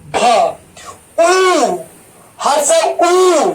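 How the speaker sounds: उ with lips pushed far out; laughing demo of उ, lips thrust forward. Shown as a demonstration. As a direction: stretch out the उ with rounded lips. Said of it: "कितना ओठ एकदम बाहर आ रहा है".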